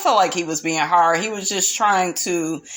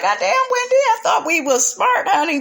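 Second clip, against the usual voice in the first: high, incredulous scolding; exasperated; 12 semitones higher than usual. Retold or imitated. Host scolding Wendy for acting dumb, high exasperation. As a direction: throw up your hands at her, up high. Her own reaction, exasperated.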